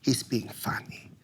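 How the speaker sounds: very soft, half-whispered imitation of a mother soothing; hushed, reassuring mom. Mom telling her son not to worry, hushed reassurance. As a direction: play down the upset in a tiny, hushed voice. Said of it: "my mom was like".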